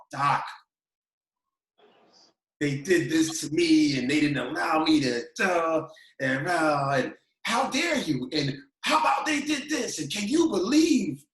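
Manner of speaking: high, loud, slowed venting; pouring his heart out. Retold or imitated. A young assistant pleading his grievances to his old coach. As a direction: unload every grievance in a rush of hurt.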